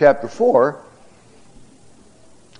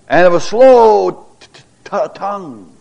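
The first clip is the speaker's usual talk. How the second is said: a light mock stammer catching on the t; stuttering; 5 semitones higher, 5 dB louder than usual. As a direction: stumble over tongue as if the word won't come. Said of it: "that's how he talked".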